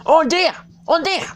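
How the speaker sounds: squeaky high, loud panicky rabbit. A narrator's reading. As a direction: squeal it like a frantic little rabbit. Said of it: "hear the rabbit say to itself".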